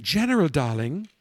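imitated British hostess accent. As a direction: play the British hostess addressing the general. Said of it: "in her British accent".

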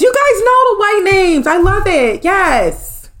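high-pitched gleeful approval; real delight. Real, not acted. Streamer thrilled by her viewers' name suggestions, delighted.

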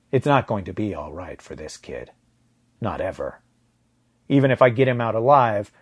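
plain reading voice

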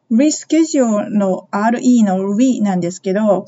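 ordinary talk, nothing marked

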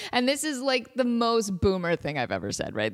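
ordinary talk

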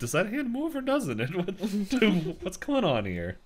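high-pitched mock bewilderment, settling at the end; retold bafflement. Retelling Dipper's reaction to the hand, mock baffled. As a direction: play a baffled kid, voice jumping up. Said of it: "He's like".

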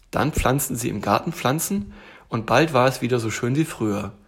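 ordinary delivery, nothing marked

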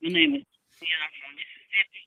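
quiet, far-off speakerphone voice; talking from across the room on speakerphone. Real, not acted. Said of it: "I still can't hear you".

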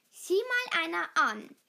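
loud, mildly sneering jibe; growled taunt. A narrator's reading. Wirbelwind mocks Schneeball for missing his mother, taunting. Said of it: "knurrte Wirbelwind".

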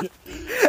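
high squealing laugh. Real, not acted. Friend laughs at his own teasing, squealing.

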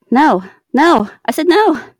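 loud, urgent yell. Real, not acted. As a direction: shout the order. Yelling to shoot.

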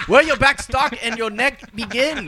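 slow, high fake Chinese accent; hammed-up Chinese accent, drawn out; mock Chinese accent. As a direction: puzzle it out in a caricatured Chinese accent. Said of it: "everybody can do a Chinese accent".

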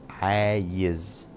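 "عايز" spelled out slowly for learners; slow male form. Shown as a demonstration. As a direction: draw out "عايز" gently, aayiz. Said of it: "أنا".

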